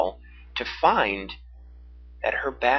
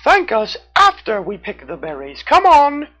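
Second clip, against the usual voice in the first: eager raccoon; 11 semitones higher, 9 dB louder than usual. A narrator's reading. Raccoon urges them.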